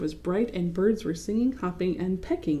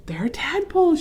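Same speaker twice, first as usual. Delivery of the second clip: shouted; 9 semitones higher, 4 dB louder than usual. A narrator's reading. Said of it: "she shouted".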